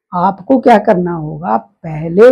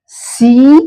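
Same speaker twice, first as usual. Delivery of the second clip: normal talking voice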